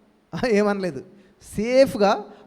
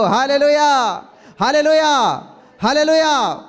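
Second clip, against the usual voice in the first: full-force preaching; 4 dB louder, slower than usual. Acted. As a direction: preach it out to the church with rising fervour. Pastor preaching to congregation.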